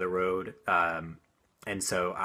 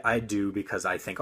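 ordinary talk

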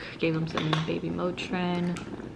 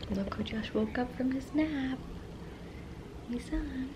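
soft cooing; 8 semitones higher, 7 dB quieter than usual. Real, not acted.

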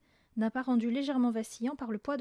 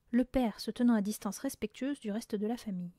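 ordinary delivery, nothing marked

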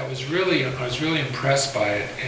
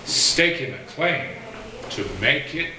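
measured, rhythmic poetry reading with pauses; poem recital; 5 semitones higher than usual. Acted. Poet reading his own poem to an audience, measured recital. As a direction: let each rhymed line land, then breathe.

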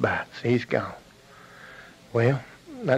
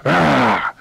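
loud, sudden bear roar; 6 dB louder than usual. Acted. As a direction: roar as you pounce. Bear pounces at the monkey.

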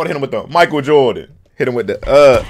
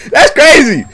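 high, loud exclamation; amused disbelief; 13 semitones higher, 9 dB louder, slower than usual. Real, not acted. Watcher laughing at a crazy move, loud disbelief. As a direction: blurt it out loudly after laughing.

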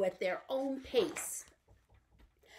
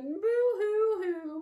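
high, drawn-out fake boo-hoo; mock crying; 7 semitones higher, slower than usual. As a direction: wail it playfully, long and high. Mock crying for the gone dinosaurs.

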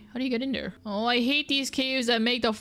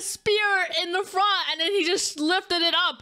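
incredulous; 9 semitones higher than usual. Real, not acted. Shocked after dying to spear.

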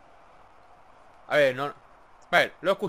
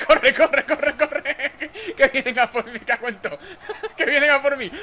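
being chased; 5 semitones higher than usual. Real, not acted. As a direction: run and laugh in terror. Fleeing enemies, laughing.

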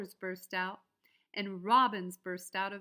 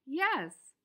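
higher, louder happy yes; bunny welcome; 6 semitones higher, 6 dB louder than usual. A narrator's reading. Bunny inviting the bunny home, glad yes. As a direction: welcome him in gladly. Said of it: "said the bunny".

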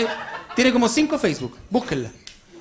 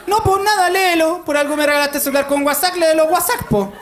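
much higher, louder mock mom insisting; mother's voice; 7 semitones higher, 4 dB louder than usual. Son imitates his mother on the phone in a high insistent voice. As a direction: play the stubborn mother, pitched way up.

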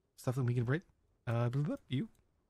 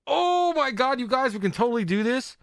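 high, louder excited outburst; 8 semitones higher, 4 dB louder than usual. Real, not acted. Streamer thrilled to chat, excited squeal. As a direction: burst out with delight to the viewers.

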